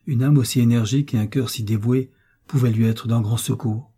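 ordinary delivery

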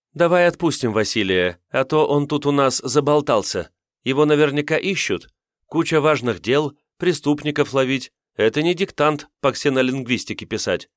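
light teasing to end the chat; teasing girl voice. Acted. Semal teasing to rescue friend.

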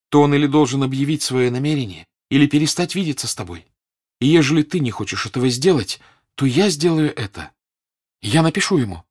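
resolute. A narrator's reading. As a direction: lay down the ultimatum decisively. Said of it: "решительно сказала Соня".

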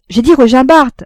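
loud, high outburst of exasperation; exasperated cry. A narrator's reading. Frédéric corrects the café keeper's mistake, exasperated. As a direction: cry it out, exasperated at being misheard. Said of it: "s'écria Frédéric exaspéré".

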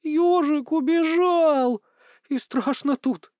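slow, very high crying voice; tearful wail. A narrator's reading. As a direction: wail it like an upset little child. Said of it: "плачущим голосом сказала Мила".

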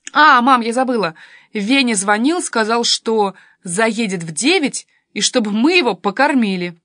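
put-on a touch of an eager teenage girl; lightly voiced lively daughter. A narrator's reading. (оживлённая дочка, громко)

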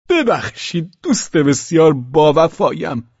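put-on high, loud drunken cry. A narrator's reading. Drunken host shouting a high greeting. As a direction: Pavel calls out effusively to Velchaninov. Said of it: "فریاد کشید".

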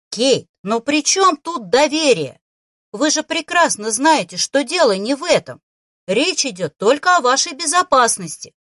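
loud, growled angry protest; growling. A narrator's reading. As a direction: growl it out, losing patience. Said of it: "прорычал принц".

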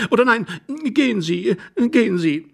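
high, agitated woman's voice; flustered young wife. Acted. Wife sends husband away after all, flustered. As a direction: reverse yourself in a high fluster.

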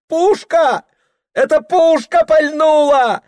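put-on high, loud boy's cry; shouting. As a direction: cry out in alarm like a boy. Boy cries out in church.

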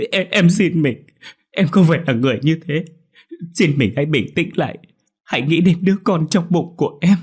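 high, loud, begging voice; desperate pleading. Acted. Beaten wife begging her husband to believe her, desperate plea. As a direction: beg him for mercy through the pain. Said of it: "Hạnh cố gắng ngồi dậy van xin".

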